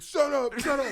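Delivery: high, loud mock yell; retold barking order; angry cop shouting. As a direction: snap it out like a fed-up cop. Retold cop with nightstick yelling.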